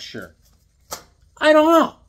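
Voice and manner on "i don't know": high, loud comic "don't know" yelp; sudden playful holler; mock outburst. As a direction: blurt it out in hammed-up exasperation. Mock outburst about missing issue knowledge.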